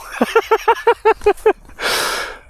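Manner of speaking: high wheezy run of laughter; long, high-pitched chuckle. Real, not acted. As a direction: laugh helplessly as the plan falls apart. Laughs at plan going wrong.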